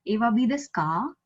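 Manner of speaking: "Ewa bi desu ka" with ka sweeping upward; rising intonation. Shown as a demonstration. Teacher showing how questions sound, rising on ka. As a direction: lift the final ka into a question. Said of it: "My accent is rising".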